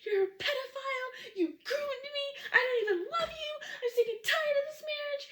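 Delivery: shrill-pitched furious wife yelling, crying; retold screaming. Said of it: "Josie starts screaming at her husband".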